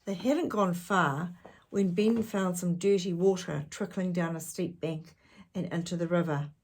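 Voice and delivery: ordinary delivery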